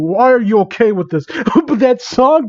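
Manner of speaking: usual speaking voice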